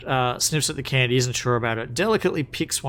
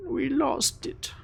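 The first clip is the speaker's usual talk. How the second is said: tiny, high kobold lament; upset kobold; 5 dB quieter than usual. Acted. Kobold grieving his stolen dragon, small upset voice. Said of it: "starts crying again".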